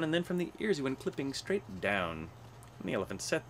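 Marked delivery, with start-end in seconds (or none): none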